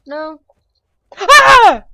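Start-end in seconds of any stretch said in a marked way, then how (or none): 1.2-1.8 sudden piercing scream, high and very loud; scream of fright. Real, not acted. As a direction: shriek as it jumps you.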